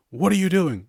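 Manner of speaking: alarmed parent. Retold or imitated. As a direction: catch the toddler mid-grab. Parent shouting at toddler.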